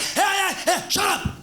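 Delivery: very high, yelping voice; mock hyper outburst. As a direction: yelp it like a hyper kid. Hyper child being told off.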